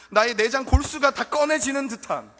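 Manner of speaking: loud, high impassioned cry; preaching burst. Acted. Preacher crying out to the congregation, loud. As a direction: burst out on the image.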